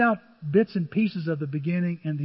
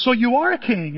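Pilate's challenge; 6 semitones higher, 7 dB louder than usual. Acted. Pilate questions Jesus.

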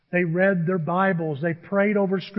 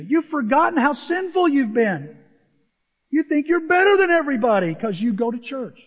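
rising rebuke; 7 semitones higher, 3 dB louder than usual. Acted. Preacher rebuking self-righteous listeners.

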